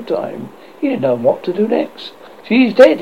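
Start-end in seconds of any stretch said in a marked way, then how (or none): 2.5-3.0 uncomfortable. A narrator's reading. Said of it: "he said uncomfortably".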